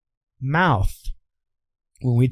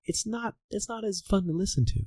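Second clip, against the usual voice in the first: much higher and much softer, a thin open tone; open unfocused voice; 8 semitones higher, 11 dB quieter than usual. Shown as a demonstration. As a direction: drop the focus and let it float soft and high. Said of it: "started talking like this with the same sound".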